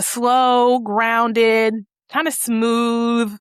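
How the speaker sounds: slow, grounded, smooth delivery; drawn out to show the snake's voice. Shown as a demonstration. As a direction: stretch it out slowly and smoothly. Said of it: "his voice was like a".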